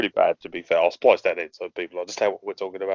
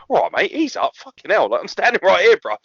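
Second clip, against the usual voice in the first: mock protest, pitched up; Chris Benoit; 9 semitones higher, 4 dB louder than usual. Wrestler telling his screaming rival to ease up, mock exasperation. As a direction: plead with him to back off, voice raised in pitch. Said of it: "even Chris Benoit's like".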